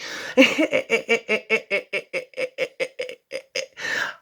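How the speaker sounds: breathy Chucky laugh. Pretend. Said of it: "Armageddon genius like Chucky".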